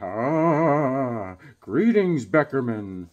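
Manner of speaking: drawn-out ghostly greeting, sinking low; mock-solemn spirit voice; [name]. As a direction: intone it like an apparition. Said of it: "an apparition comes".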